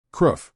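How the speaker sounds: short harsh beastly growl. Acted. Possessed man growls at the one trying to save him. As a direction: snarl it like a cornered beast. Man fighting his inner demon.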